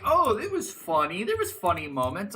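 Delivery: high-pitched mock protest; mocking defenders. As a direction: imitate people making a feeble defence. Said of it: "They're like".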